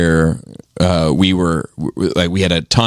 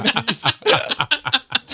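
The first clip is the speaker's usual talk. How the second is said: mock Vietnamese accent; 5 dB quieter, much slower than usual. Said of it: "but try harder".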